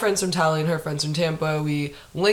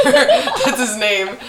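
laughing; 6 semitones higher, 5 dB louder, much slower than usual. Real, not acted. Laughing at guy's name.

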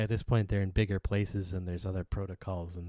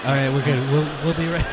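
loud, high and hurried; shouting over fire alarm; 5 semitones higher, 9 dB louder, faster than usual. Pretend. Staged panic.

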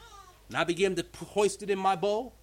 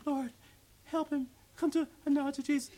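half-hearted churchy prayer, dragged out; mock a weak formal prayer; limp prayer; 6 semitones higher than usual. Shown as a demonstration. Preacher mocking the prayer you should not pray, half-hearted. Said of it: "you don't say those prayers like".

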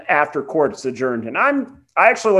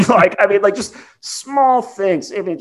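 normal talking voice

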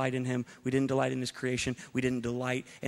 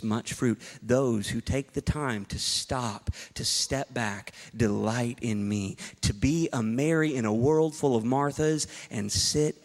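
slow sermon voice building to a shout; preaching; slower than usual. Acted. Preacher voicing God to the congregation, building to a shout. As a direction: preach it slowly, rising to full force.

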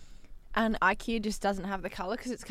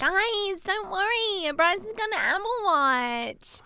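high, silly drawn-out voice; exaggerated annoying accent, stretched words; annoying put-on voice; 11 semitones higher, 3 dB louder, much slower than usual. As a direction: reassure them in a mock high whine. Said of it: "most annoying, like, voice or accent".

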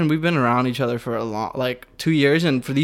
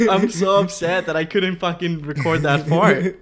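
laughing; 4 semitones higher, slower than usual. Real, not acted. Laughing over lost fart.

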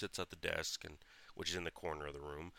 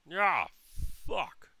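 high, loud startled yelp from far off; imitated mom yell; 11 semitones higher, 10 dB louder than usual. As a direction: yell like mom in trouble on the porch. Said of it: "my mom from the porch yell".

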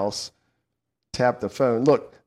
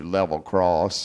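slow Southern drawl on Level Cross; Southern accent; 7 semitones lower, slower than usual. Shown as a demonstration. Host redoes the town name for listeners, Southern accent. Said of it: "You gotta say that with a Southern accent".

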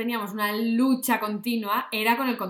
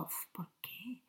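whispered sigh; 18 dB quieter, slower than usual. Retold or imitated. Inner thought, exasperated.

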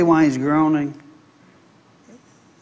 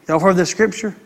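normal talking voice

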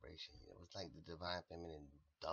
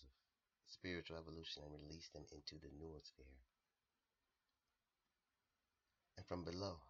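closing mantra. Acted. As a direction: intone it like a blessing.

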